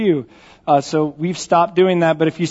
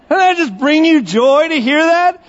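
ordinary talk

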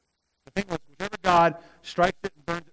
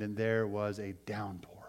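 whispered reading; 8 semitones lower, 9 dB quieter, slower than usual. Real, not acted. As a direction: whisper the verse slowly. Reading the verse aloud.

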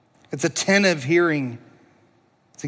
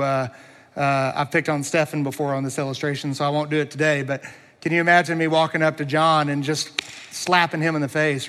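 ordinary talk, nothing marked

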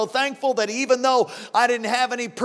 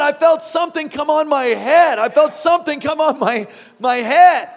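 loud, rising retelling of his words; breathless convert quote; excited testimony; 4 semitones higher, 8 dB louder than usual. Retold or imitated. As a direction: relive his amazement out loud.

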